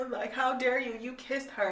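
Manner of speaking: mock her being offended; mock indignant. Mocking Tessa whining at Hardin, mock indignation. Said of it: "And then be like".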